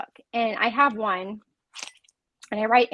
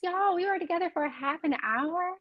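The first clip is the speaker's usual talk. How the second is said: surprised; 8 semitones higher than usual. Real, not acted. Surprised time went fast.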